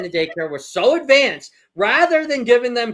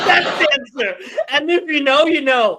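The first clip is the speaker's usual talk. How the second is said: high, loud, helpless laughing speech; crying with laughter; 10 semitones higher, 4 dB louder than usual. Real, not acted. Host cracking up over the game answer, crying with laughter. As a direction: squeal the words through real laughter. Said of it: "I'm literally crying".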